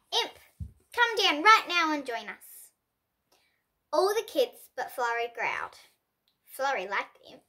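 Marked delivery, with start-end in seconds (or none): 0.1-2.7 a bit louder, firm call up the hill. A narrator's reading. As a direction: call the girl down to join the class. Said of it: "Miss Franklin called".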